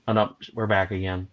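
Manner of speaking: normal talking voice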